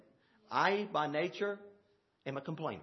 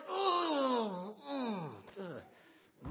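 a mock crying groan, trailing off much softer; inner groan; 5 dB quieter, much slower than usual. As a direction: let out a half-sobbing groan of despair. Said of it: "my inside me was like".

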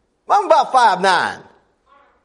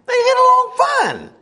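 "They get along fine" repeated much higher, ringing out; high emphatic repeat. Shown as a demonstration. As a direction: throw "fine" up high to drive the point home. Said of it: "And they get along fine".

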